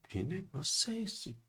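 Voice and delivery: soft, slow, high, bewildered question. Retold or imitated. Man seeing his body on the floor, hushed and amazed. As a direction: wonder aloud at the strange sight.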